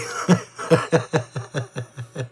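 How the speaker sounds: warm amused chuckle; laughing. Real, not acted. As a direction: laugh at your own joke. Laughs at own joke.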